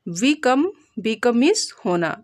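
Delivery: ordinary talk, nothing marked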